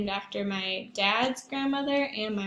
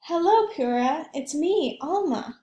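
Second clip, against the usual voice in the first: put-on little girl voice; 6 semitones higher, 5 dB louder than usual. Child Alma greets her aunt.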